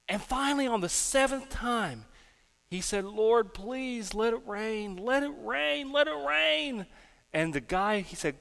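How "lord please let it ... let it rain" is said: high, drawn-out pleading; acted-out imploring chant to heaven; desperate prayer. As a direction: beg over and over, pitch climbing. Seventh acted prayer for rain.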